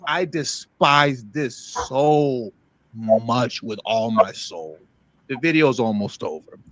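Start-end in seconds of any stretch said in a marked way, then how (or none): 0.0-4.8 very slow, each word weighed; slow emphatic disgust. Real, not acted. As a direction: drag out your contempt slowly. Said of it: "I'm getting a little emotional".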